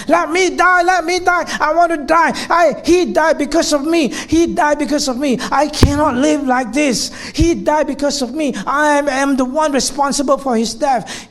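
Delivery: high, loud anguished wailing; grieving girl screaming in hospital; screaming in grief. Retold or imitated. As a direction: wail it out in guilt and despair. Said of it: "she's screaming and yelling".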